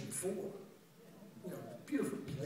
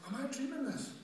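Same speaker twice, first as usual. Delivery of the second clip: high-pitched retold disbelief; freaked out; 11 semitones higher than usual. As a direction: voice jumps up as if doubting reality. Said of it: "I'd freak myself out".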